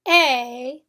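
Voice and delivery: a said as long A as in apron; long vowel a, like apron. Shown as a demonstration. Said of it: "the long vowel sound".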